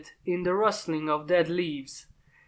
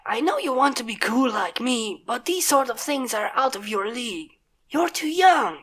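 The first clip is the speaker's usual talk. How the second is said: a hint of a cocky big sister; lightly voiced older pony; 5 semitones higher than usual. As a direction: talk down kindly but firmly. Rainbow lectures Scootaloo.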